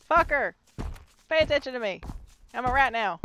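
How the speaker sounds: loud, high jeering shouts; taunting yell. Acted. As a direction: holler to make him notice you. Yelling at the monster to notice him.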